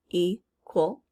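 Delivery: EQUAL broken in two, "ee ... kwul"; syllable by syllable. Shown as a demonstration. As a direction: split EQUAL slowly into its syllables. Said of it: "Q U in the second syllable".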